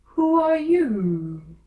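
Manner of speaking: very slow, loud, high scornful drawl; slow, loud, scornful caterpillar; contemptuous. A narrator's reading. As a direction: look down on her as you drag it out. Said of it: "said the caterpillar contemptuously".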